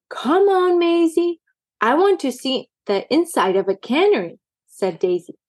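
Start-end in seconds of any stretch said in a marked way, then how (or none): 0.1-4.4 eager urging. A narrator's reading. Eager kitten urging twin.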